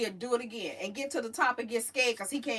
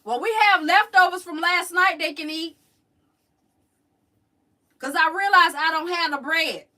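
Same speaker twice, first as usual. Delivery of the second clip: louder, higher voice calling over; calling across house; 5 semitones higher, 9 dB louder than usual. Real, not acted. Telling family about leftovers, called out loud. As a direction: project to someone in another room.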